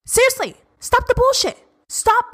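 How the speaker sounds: emphatic outburst. Real, not acted. Sudden high emphatic outburst.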